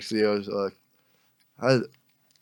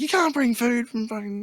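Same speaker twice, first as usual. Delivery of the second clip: high, softer mimicked nagging; mock high-pitched scolding; scolding customs lady; 10 semitones higher, 5 dB quieter than usual. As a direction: imitate a fussy female officer telling you off.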